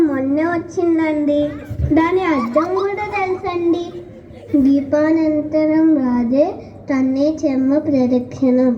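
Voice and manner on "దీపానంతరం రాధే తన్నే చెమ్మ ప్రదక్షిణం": shloka recitation. Acted. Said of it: "నిన్నటి శ్లోకం గుర్తుందా".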